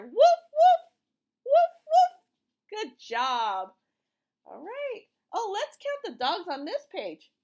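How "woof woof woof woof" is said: loud, high playful woofs; barking together. Shown as a demonstration. Teacher barking with the class, loud and high. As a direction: bark along with the kids. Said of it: "Let's bark together".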